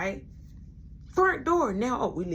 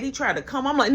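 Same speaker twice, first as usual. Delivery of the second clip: ordinary talk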